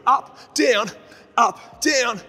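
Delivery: loud, high driving count; shouted count. Real, not acted. Coach counting down final reps, shouting. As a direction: bark out the last reps.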